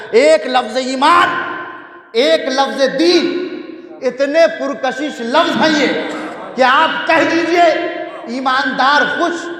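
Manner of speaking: high, loud impassioned preaching; preaching at full force. Real, not acted. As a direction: build it to a ringing climax. Impassioned climax of the majlis. (پرجوش بلند آواز میں خطابت)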